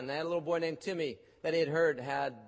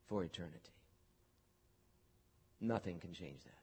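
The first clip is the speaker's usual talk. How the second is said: soft reassurance; 6 semitones lower, 8 dB quieter than usual. Acted. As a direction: drop to a tender hush of comfort. Comforting promise after fiery passage.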